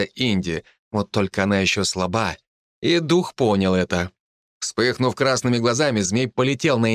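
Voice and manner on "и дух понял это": ordinary delivery, nothing marked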